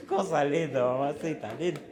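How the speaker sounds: laughing through doting words; fond laugh. Real, not acted. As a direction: chuckle it fondly. Laughing fondly over baby.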